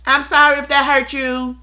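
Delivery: light, high, breezy "I'm sorry"; high-pitched apology. Retold or imitated. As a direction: toss off a high automatic sorry. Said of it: "You're so up here with it".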